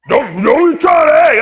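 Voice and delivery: loud mock scolding; telling off. Comedian retelling ordering a date away, loud scold. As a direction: bark it at her like a warning.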